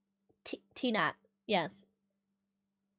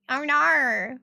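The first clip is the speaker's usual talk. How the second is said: mock Australian accent. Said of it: "an imitation of every fucking Australian".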